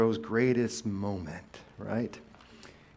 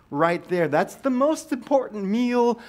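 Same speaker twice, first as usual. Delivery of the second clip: much higher, louder joking burst; playful high shout to the congregation; excited preaching; 8 semitones higher, 6 dB louder than usual. Real, not acted. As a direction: hype up breakfast like a revival point. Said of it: "I'm preaching to someone this morning".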